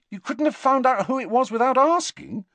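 high, loud exasperated question; frustrated. A narrator's reading. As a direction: let frustration push the voice up. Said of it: "slightly frustrated".

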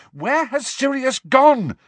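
high, loud, drawn-out yell; desperate yell. Acted. Harry yells after the departing elf, desperately. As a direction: yell it after someone walking away. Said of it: "Harry yelled after the elf".

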